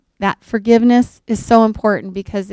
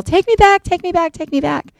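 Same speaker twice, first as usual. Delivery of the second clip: much higher, desperate pleading that fades; hysterical pleading; 10 semitones higher than usual. Retold or imitated. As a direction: beg to be taken back, panicking, then dying down. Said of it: "and is hysterical".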